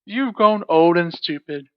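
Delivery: deep, slow, loud giant voice; loud giant scolding. Acted. Giant scolds his wife, loud and slow. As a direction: scold her like a huge brute. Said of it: "in his loud tones".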